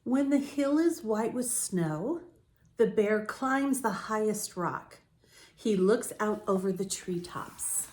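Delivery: slow, gentle read-aloud; story narration. A narrator's reading. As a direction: read it calmly to young listeners. Reads picture book to children.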